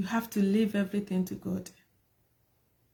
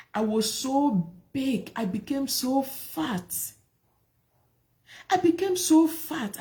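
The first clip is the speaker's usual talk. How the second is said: ordinary talk, nothing marked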